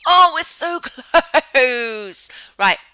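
loud, high wail broken by laughter; anguished laughing wail. Real, not acted. Gamer just short of paying the bills, laughing wail. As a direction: cry out in frustration, laughing through it. Nearly enough money for bills.

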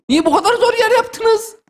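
high, drawn-out complaint; exasperated, pitched well up; exasperated protest. Real, not acted. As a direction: wail it slowly at the makers. Protests the level's difficulty.

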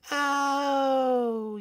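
long, high, sinking oh; high princess voice, deflating; dismayed princess. Acted. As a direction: draw out the realisation, high-pitched. Princess realises the problem.

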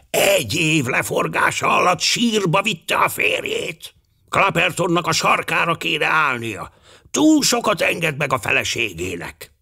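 put-on high old man's lament; mournful old gentleman. A narrator's reading. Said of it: "Az öreg úr bánatosan ingatta a fejét". (magas hangú, bánatos öregúr)

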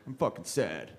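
low, sulky mock whine; mock mope. Comic mimicking people who are down for no reason, low mock mope. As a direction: grumble it low like a sad sack. Mopey self voiced.

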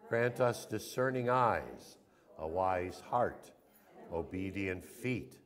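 steady, earnest prayer led for the room. Acted. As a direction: pray it aloud for the congregation. Leads congregational prayer.

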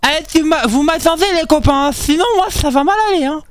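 high, panicky begging; scared pleading. Real, not acted. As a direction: beg your friends not to leave you. Supplie les copains de l'attendre. (supplication paniquée et aiguë)

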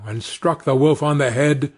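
plain reading voice

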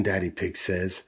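ordinary delivery

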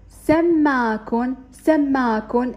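سماكن said slowly with rising end; slow blending. Shown as a demonstration. Teacher reading سماكن slowly to pupils. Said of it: "المقاطع هي سم، ما".

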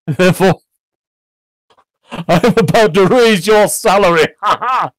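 loud, high-pitched exultant burst; gleeful announcement. A narrator's reading. Scrooge springs the raise on his clerk, loud glee. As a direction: proclaim it in high glee, breaking into laughter.

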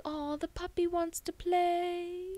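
high, slow cooing taunt; mock baby talk. Willow taunting chained Spike, cooing high and slow. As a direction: coo at him like a puppy.

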